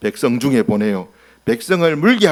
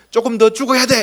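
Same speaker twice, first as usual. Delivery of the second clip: mock spite; 7 semitones higher, 4 dB louder than usual. Mock Moses refusing.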